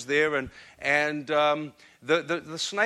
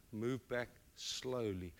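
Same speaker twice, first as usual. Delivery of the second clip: a softer, lower voice, mother kneeling to her son; mother holding her son's face, speaking gently; soft, earnest mother; 5 semitones lower, 16 dB quieter, slower than usual. Retold or imitated. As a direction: speak gently to a small child. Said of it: "she said".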